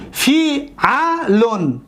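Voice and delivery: فی علون very slow, broken into long syllables; fi, a, lun, each drawn out. Shown as a demonstration. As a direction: sound out فی علون piece by piece. Teacher sounds out pattern.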